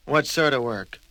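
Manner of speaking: lightly voiced Mister Messy; wary question; puzzled question.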